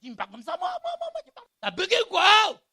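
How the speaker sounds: high, loud outburst of indignation; mock-furious shout at the rude pastor; outraged shout. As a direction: yell it like you're boiling over. J'avais envie de bouillir.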